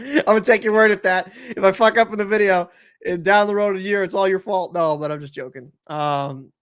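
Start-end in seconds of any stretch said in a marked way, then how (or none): 0.0-2.7 real laugh running into louder, higher words. Real, not acted. Streamer doubting chat's pronunciation tip, laughing as he speaks. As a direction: crack up, then talk through it.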